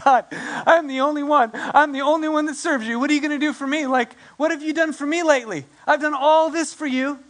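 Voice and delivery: high, self-pitying whine; a prophet complaining to God that he is unappreciated, high and whiny; whiny Elijah. Retold or imitated. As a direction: whine it up high, demanding credit. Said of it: "Elijah's like".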